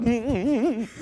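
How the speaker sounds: high wavering mock hum; excited squeal imitation. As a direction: imitate a girl's excited humming, high-pitched. Imitates excited girl's response.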